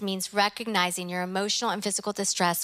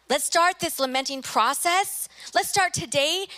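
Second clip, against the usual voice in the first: rousing call; 7 semitones higher than usual. Real, not acted. Preacher rallies the congregation.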